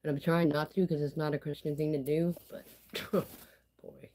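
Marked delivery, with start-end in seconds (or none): 2.5-4.1 whispered laugh. Real, not acted. Whispered aside.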